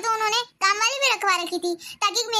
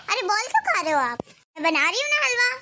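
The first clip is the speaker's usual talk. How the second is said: ordinary talk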